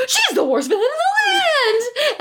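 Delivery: high, slow, hammed-up shriek of outrage; mock melodrama, way too big; overacted villain line. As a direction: ham it up like a bad TV mom. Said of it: "Dial it down, dial it down about five notches".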